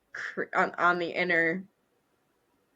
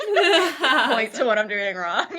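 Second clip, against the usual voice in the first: laughing; 4 semitones higher, 7 dB louder, slower than usual. Real, not acted. Laughing while retelling the quote.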